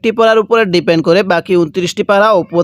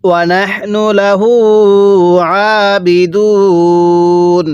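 slow, drawn-out tajweed chant; Quran recitation; much slower than usual. Shown as a demonstration. Teacher recites the verse for students, slow tajweed chant. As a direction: intone each syllable slowly like a reciter. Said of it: "আমার সাথে পড়ুন".

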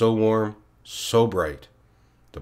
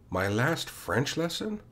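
higher, slow, rising "My last French lesson?" in disbelief; stunned disbelief; 6 semitones higher, slower than usual. A narrator's reading. Boy repeats the teacher's words to himself, stunned and rising.

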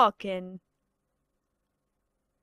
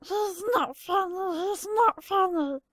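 higher, tearful whine; whining cartoon voice; 7 semitones higher, 3 dB quieter than usual. Sulking character whining he's not funny. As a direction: wail it like a sulky cartoon. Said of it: "some of these impressions".

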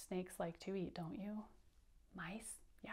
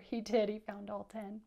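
ordinary talk

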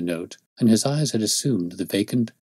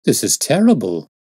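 dismayed cry; 3 semitones higher, 6 dB louder than usual. Acted. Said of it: "I cried".

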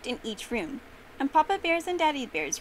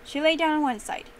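usual speaking voice

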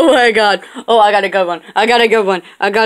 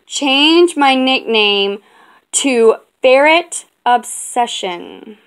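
slow dictation; slower than usual. Real, not acted. Re-dictating to Siri.

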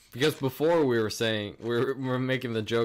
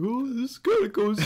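much higher put-on guest voice; high mocking voice; 13 semitones higher, 4 dB louder than usual. Mocking a podcast guest, high silly voice.

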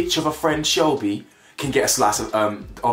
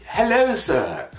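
posh phone voice; 7 semitones higher, much slower than usual. Retold or imitated. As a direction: ham up an overly formal phone greeting. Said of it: "talking on the phone and goes".

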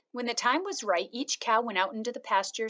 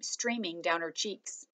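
ordinary delivery

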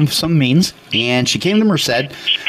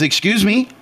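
high, incredulous retort; mock-disbelieving question; shocked excuse me; 8 semitones higher than usual. Friend retells shocked reply.

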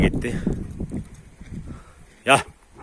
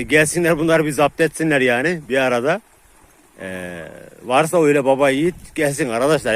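heated challenge; 6 semitones higher, 6 dB louder than usual. Real, not acted.

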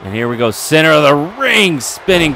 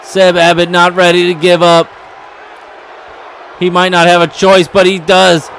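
frenzied upset call; 7 semitones higher than usual. Real, not acted. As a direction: call the upset like the crowd is exploding. Commentator calls the upset win.

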